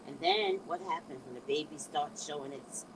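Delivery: ordinary talk, nothing marked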